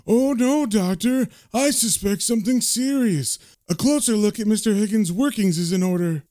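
high-pitched mimicry of a concerned nurse; Sister Morgan. [name] mimics the nurse addressing the doctor, high-pitched and officious. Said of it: "Then Sister Morgan turns up and says".

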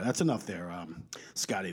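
hushed unvoiced whisper. Real, not acted. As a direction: whisper it like a quick aside. Cutting off Scotty, hushed.

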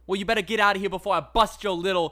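loud, high mock-tough threat; dolphin threatening. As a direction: bark it out as a cocky dolphin. Dolphin character threatens Colin.

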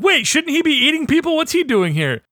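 high, puzzled mock question; mock baffled question. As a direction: act baffled at the casting. Mocking viewer reaction to casting.

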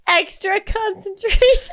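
squeaky high giggles with tears, words barely held together; trying to explain through helpless, teary laughter; laugh-crying. Real, not acted. Still crying with laughter while explaining it to her cohost. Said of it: "She's crying real tears".

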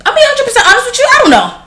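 high, fast, breathless reply; mom's honest answer. Retold or imitated. The mother to the father at the door, high and fast. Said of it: "My mother was like".

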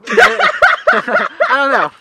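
high burst of laughter through words; laughing. Real, not acted.

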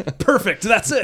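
high, loud, gushing approval; mock delight. Casting people approving an impression on the spot, mock delighted. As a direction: exclaim it like a thrilled casting panel.

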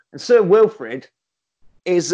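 ordinary talk

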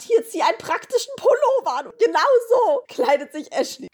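high nagging mother voice; mom voice. Retold or imitated. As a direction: nag like a caring mom. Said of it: "Wenn meine Mutter sagt".

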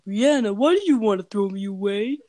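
loud put-on clown doll voice; creepy doll confronting its owner loudly; Fido's voice. Giant doll asks why she threw him away, loud creepy voice. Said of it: "that's the voice that she used to give Fido".

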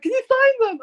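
high, loud eager request; excited asking. Retold or imitated. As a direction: ask it high and thrilled. Cashier voiced in retold story.

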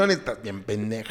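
low, soft sneering doll voice; low creepy doll. Retold or imitated. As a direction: growl it quietly as the doll.

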